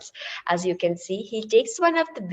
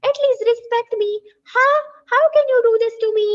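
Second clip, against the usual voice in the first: high, loud and fast furious tirade; shouting scolding; 11 semitones higher, 6 dB louder, faster than usual. Acted. Old lady shouting at the boy who took her cookies, furious scolding. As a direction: tear into the little boy at full volume. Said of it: "she's shouting and scolding him".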